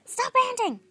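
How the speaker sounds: high, loud outburst; angry shout. Acted. Fed up with someone's ranting, angry shout. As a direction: yell to cut someone off. Acted quarrel.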